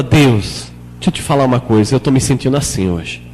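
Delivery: low, soft talk with God; quietly personal, unceremonious prayer; natural conversational prayer. Shown as a demonstration. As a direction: confide in God as to a friend. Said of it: "Vai conversando com Deus de maneira natural".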